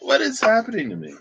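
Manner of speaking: high, quick, giggly disbelief; laughing bewilderment. Real, not acted. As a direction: squeal it out through laughter. Baffled at herself after laughing.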